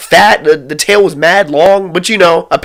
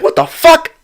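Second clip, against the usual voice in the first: shocked; 11 semitones higher than usual. Retold or imitated. Reacting to friend's scream.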